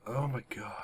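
whisper, barely breathing; a tense, frightened whisper; scared whisper. Real, not acted. Hiding from monster.

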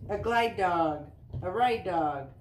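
bouncy sing-song picture-book reading; reading to children. Acted. Mom reading a dog book to Easton, sing-song read-aloud. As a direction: read the rhymes with a lilt for little kids.

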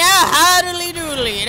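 high, drawn-out goofy exclamation; a cartoonish silly cry.

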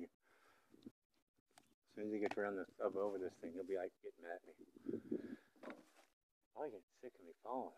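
hushed and soft, half under the breath. Real, not acted. Filmer hiding from the bull, speaking hushed. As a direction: keep it low so the bull won't hear.

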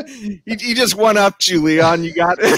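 high-pitched, amused, through laughter; laughing. Real, not acted. Host teases panelist over being one-upped, laughing. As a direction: tease him while cracking up.

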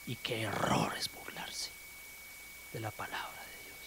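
low, soft whispered warning; sermon aside whispered to church; hushed whisper. Real, not acted. Preacher drops to hushed warning.

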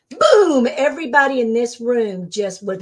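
usual speaking voice

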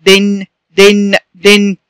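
short i in دن, then hum the n; kasra tanween. Shown as a demonstration. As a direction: say دن slowly as "din" with a held n. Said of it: "تنوين بالكسر". (نطق دن "دِنْ" بنون ساكنة)

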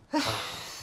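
long breathy sigh; weary sigh. Acted. Doctor alone after tense calls, weary sigh. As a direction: let out a heavy sigh.

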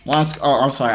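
normal talking voice

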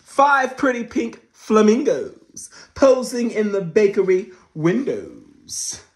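loud, high dramatic read-aloud; excited read-aloud; storybook reading. A narrator's reading. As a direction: announce it like big news to kids. Reading the book.

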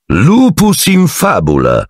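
high, drawn-out mocking exclamation; exclaiming. A narrator's reading. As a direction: pounce on the late arrival, stretching the words. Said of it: "esclamò". (esclamazione acuta e strascicata)

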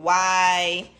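ordinary talk, nothing marked

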